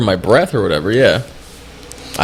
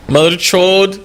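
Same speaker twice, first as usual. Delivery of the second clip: put-on Indian accent; 9 semitones higher, 3 dB louder, slower than usual. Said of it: "That's how I have to say it".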